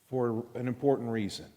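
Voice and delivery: ordinary talk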